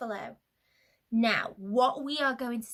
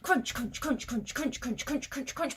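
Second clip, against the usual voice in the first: rapid, rhythmic CRUNCH after CRUNCH; quick crunching. Shown as a demonstration. Getting away from Snake quickly, rapid crunching. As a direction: rattle off each crunch like hurried footsteps. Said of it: "We're gonna crunch quicker this time".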